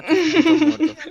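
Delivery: slow, loud, high laughing yell; laughing shout. Real, not acted. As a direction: yell it while cracking up. Shouts insult laughing at friend.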